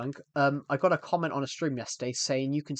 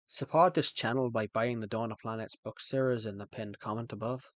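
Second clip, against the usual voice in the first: lower-pitched put-on Irish reading; Irish accent; 4 semitones lower, 3 dB quieter than usual. Shown as a demonstration. Streamer reads pinned chat comment aloud, Irish accent. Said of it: "read out all your comments in Irish".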